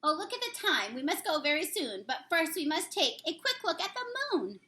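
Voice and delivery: usual speaking voice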